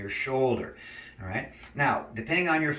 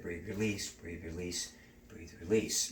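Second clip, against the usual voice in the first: soft breathing cue; 5 semitones lower, 8 dB quieter than usual. Shown as a demonstration. Coach guiding a stretch, fading soft breath cues. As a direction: lower and softer each time, fading out.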